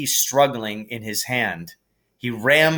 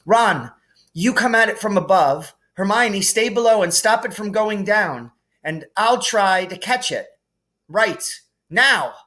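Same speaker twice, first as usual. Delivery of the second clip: urgent commands; 9 semitones higher than usual. A narrator's reading. Harry directing the others.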